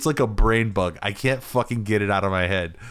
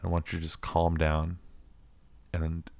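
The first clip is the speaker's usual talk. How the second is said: low, quiet, fast cop interrogation; serious cop voice; 3 semitones lower, 7 dB quieter than usual. Retold or imitated. Cop questioning a suspect across the street, quiet and serious. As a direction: lean in and grill him quietly like a stern cop. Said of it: "a really serious voice".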